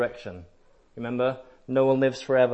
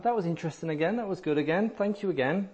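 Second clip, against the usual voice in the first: lightly mocking churchgoer, higher and airy; sing-song, higher, mock polite praise; polite mild interest; 6 semitones higher, faster than usual. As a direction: mimic someone politely unmoved. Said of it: "they'll come back".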